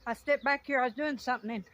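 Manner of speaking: breathless and excited after a run; out of breath. Real, not acted. Beekeeper out of breath from running to the swarm, talking excitedly. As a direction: talk between quick breaths, worked up. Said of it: "I'm out of breath from running".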